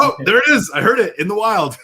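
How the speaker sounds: high-pitched mock wonder; thrilled, like spotting a rare animal; excited discovery. Acting out thrilled discovery of slang.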